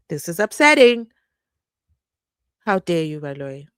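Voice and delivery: loud, then dropping low on the name; real indignation. Real, not acted. Vlogger addressing the prosecutor, loud then low indignation. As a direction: confront him with disgust.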